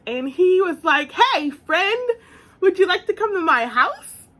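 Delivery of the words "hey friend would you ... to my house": mock casual boyfriend. Mimicking boyfriend's casual invite.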